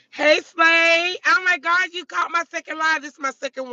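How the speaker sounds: high, loud, delighted hello to a viewer; excited greeting. Real, not acted. Streamer greeting a viewer on her live, excited. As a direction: burst out happy to see her.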